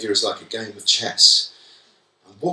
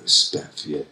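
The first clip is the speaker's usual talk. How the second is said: disdainful pupil; 9 semitones lower, 4 dB quieter than usual. Retold or imitated. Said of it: "when they go".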